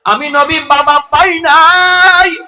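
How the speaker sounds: high, loud chanting sermon delivery; ringing, high-pitched lament to a crowd; impassioned preaching. Acted. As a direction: preach at full force, pitched far up. Preaches in the Prophet's words.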